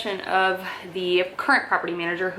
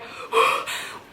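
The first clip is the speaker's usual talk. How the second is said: shocked gasp. Retold or imitated. Retelling shock at eviction threats.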